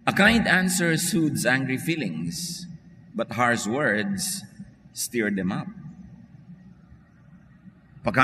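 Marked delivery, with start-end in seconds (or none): 0.1-5.7 slow verse reading; measured Bible reading; scripture reading. Acted. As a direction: read the proverb slowly and clearly. Reads scripture verse aloud.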